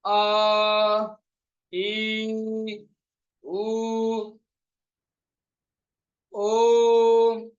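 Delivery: अ, ई, उ, ओ each held long and loud; prolonged vowels. Shown as a demonstration. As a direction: stretch every vowel to three beats. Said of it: "तो बोले जैसे".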